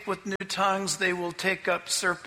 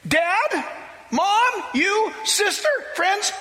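excited shout; 11 semitones higher than usual. Retold or imitated. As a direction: shout the good news to the whole family. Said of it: "He said".

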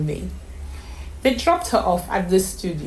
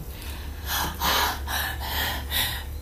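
breathless gasp; 8 dB quieter than usual. A narrator's reading. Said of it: "Only Donome was speechless".

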